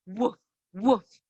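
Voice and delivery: low, deep woof like a big dog; big dog bark. Shown as a demonstration. Teacher barking for kids doing downward dog, low woof. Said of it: "big bark".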